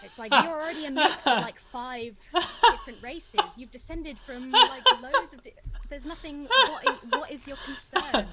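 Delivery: words breaking up with loud laughter; laughing rant. Real, not acted. Ranting while laughing at racists.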